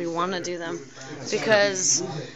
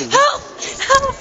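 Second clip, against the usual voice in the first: high, loud playful yelp; mock cry for help; 9 dB louder than usual. Captioner breaks into silliness, high mock cries for help. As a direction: squeal for help through the giggles.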